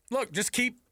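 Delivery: usual speaking voice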